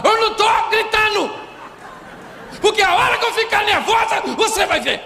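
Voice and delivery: high-pitched mock wife yelling; esposa aos gritos. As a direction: shriek it fast as an enraged wife, hammed up. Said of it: "sabe como é que a senhora fala?". (imitação exagerada de esposa gritando agudo)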